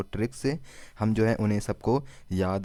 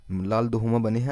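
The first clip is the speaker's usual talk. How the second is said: ordinary delivery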